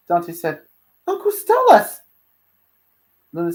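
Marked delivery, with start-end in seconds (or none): 1.1-2.0 high, loud call; excited greeting. Acted. Dante seeing his uncle appear, excited. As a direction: call out high in happy surprise.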